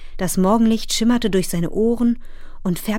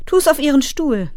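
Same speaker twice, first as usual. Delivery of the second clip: raised, higher mother's voice; brisk instruction; 7 semitones higher, 6 dB louder than usual. A narrator's reading. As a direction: tell him loudly where to put it. (laute, hohe Anweisung der Mutter)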